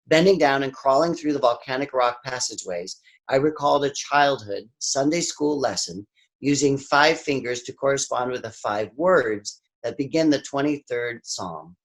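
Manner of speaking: usual speaking voice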